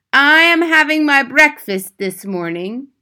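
high, loud, imperious witch; Grand High Witch crying out. A narrator's reading. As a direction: proclaim it loudly in a witch's accented voice. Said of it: "cried the Grand High Witch".

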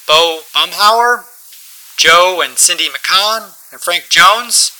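slow, loud, high reading of names; captain paging passengers off the plane, slow and loud; slow announcement. Acted. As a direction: call each name out slowly over the intercom. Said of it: "he made an announcement".

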